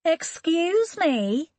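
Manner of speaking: a woman calling to strangers in her garden; neighbour woman. Acted. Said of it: "A woman appeared from the neighbouring garden".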